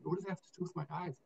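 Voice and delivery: a private thought spoken fast and hushed; muttered inner thought. Retold or imitated. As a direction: rush it under your breath, baffled. Inner thought while being examined.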